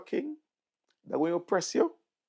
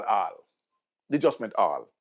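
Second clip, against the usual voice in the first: ordinary talk, nothing marked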